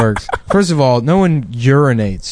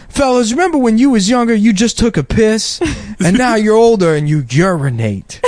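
Steve Harvey impression; 8 semitones higher than usual. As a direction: imitate a drawling stand-up bit. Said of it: "he has one special where he's talking about".

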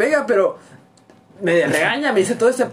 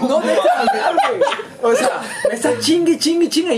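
laughing while telling; 7 semitones higher than usual. Real, not acted. Laughing about mother's nonstop scolding.

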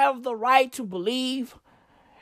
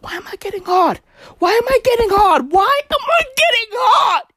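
rising mock panic; 8 semitones higher than usual. Voicing panicked Chris Pratt.

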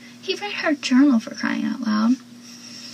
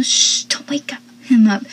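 urgent hushed whisper; shushing whisper. Retold or imitated. Joey hushing Dawson so nobody wakes, urgent whisper. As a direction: hiss it to keep someone asleep.

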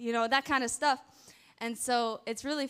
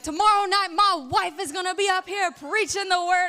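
loud, high-pitched preacher proclamation; husband under the anointing; 9 semitones higher, 10 dB louder than usual. Retold or imitated. Husband announcing his wife to the congregation, imitated fiery preaching. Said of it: "when he gets under the anointing".

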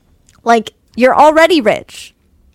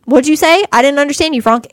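ordinary talk

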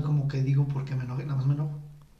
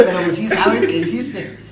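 laughing it out, loud and slow; laughing as he admits men know what they did; 4 semitones higher, 10 dB louder, slower than usual. Real, not acted. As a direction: laugh through the words. Laughing at own point.

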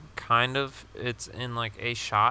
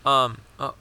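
normal talking voice

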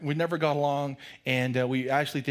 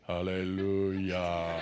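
lower, very slow and lifeless; flat bored hallelujah; 8 semitones lower, 4 dB quieter, much slower than usual. Pretend. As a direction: say it like you couldn't care less. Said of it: "we go".

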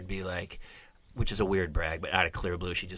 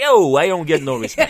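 loud comic's punchline delivery; Catskill comic; 4 semitones higher, 8 dB louder than usual. Retold or imitated. Mother voicing daughter as a stand-up, Catskill comic. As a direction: belt it like an old club comic. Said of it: "She's like a Catskill comic".

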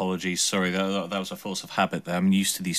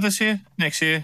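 mock confusion; 11 semitones higher, 3 dB louder than usual.

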